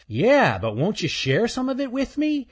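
a smug little chipmunk; teasing chipmunk. Acted. Earl's voice.

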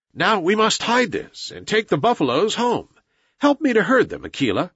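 lightly voiced brisk boy, pitched high; boy hero giving orders. Mowgli asks Akela to help with the herd, mildly commanding. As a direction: lift the pitch and direct your companion.

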